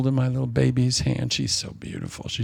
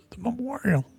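high, softer, choked-up voice; overwhelmed by grief; 11 semitones higher, 4 dB quieter than usual. Real, not acted. Recalling dad's memorial to congregation, high and overwhelmed. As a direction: push the words out high through emotion.

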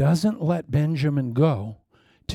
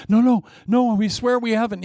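higher and louder, frantic protest; panicked denial; 7 semitones higher, 5 dB louder than usual. Acted. Accused brothers denying spying, loud panicked protest. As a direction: swear it in a rush of fear. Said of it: "brothers deny spying".

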